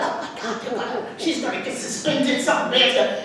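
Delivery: put-on hushed, half-laughing alarm among kids. Classmates fearing she'll be suspended, hushed and half-laughing. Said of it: "my friends were like".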